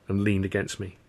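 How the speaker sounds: ordinary delivery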